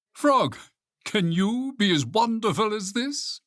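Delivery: high, showy character voice; high-pitched proud challenge; boastful Toad. A narrator's reading. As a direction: show off to a friend, pitched high. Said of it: "said Toad".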